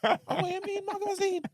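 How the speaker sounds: put-on high, laughing Jamaican mum accent. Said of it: "in a Jamaican accent".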